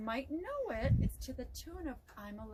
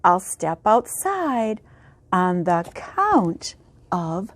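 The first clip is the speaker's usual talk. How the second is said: put-on lively, louder storybook reading for kids; reading to children; 22 dB louder than usual. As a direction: read it out big for a class of little ones. Reads a picture book aloud.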